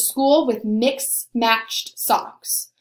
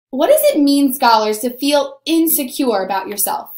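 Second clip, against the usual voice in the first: ordinary talk, nothing marked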